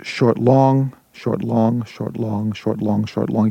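"short" light and "long" leaned on, in a steady beat; short-long meter. Shown as a demonstration. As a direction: chant the iamb short, long. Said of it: "iamb means it's a foot".

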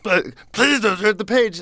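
high, pleading Muppet-monster voice; scared Grover. Retold or imitated. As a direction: beg him not to turn the page in a high furry voice. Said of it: "and he's crying".

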